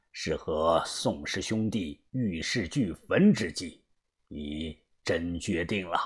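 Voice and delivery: low, quiet, grave character voice; troubled old adviser. A narrator's reading. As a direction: counsel an old friend gravely, low and soft. (低沉轻声、忧心劝告的军师)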